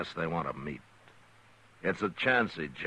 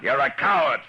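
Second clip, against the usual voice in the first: higher and louder, flung in his face; shouted insult; 7 semitones higher, 8 dB louder than usual. Acted. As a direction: yell the accusation. Repeats insult after What?.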